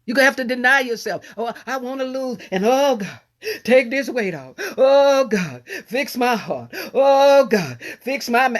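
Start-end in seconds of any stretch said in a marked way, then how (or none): 1.4-8.6 mock desperate prayer. Congregant's prayer acted out.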